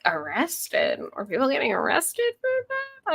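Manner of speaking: high, disbelieving question; incredulous. Retold or imitated. Host reacting to the movie line, incredulous. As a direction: sound shocked that anyone got arrested.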